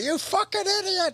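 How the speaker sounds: squeaky high dog voice. Pretend. Voicing the dog's thoughts.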